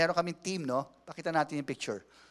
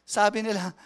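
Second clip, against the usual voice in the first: ordinary delivery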